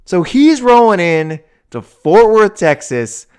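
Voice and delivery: high and loud; riled up. Real, not acted. Host riled up about Deion coming to Fort Worth, loud. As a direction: keep the worked-up energy. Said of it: "it gets me so riled up".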